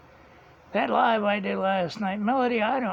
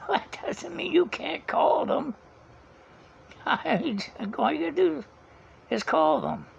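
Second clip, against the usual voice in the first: exasperated retort; 8 semitones higher than usual. Retold or imitated. Replying to mom about the bill.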